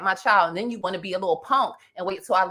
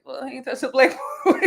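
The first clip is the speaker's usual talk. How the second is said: laughing; 5 semitones higher than usual. Retold or imitated. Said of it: "it was like".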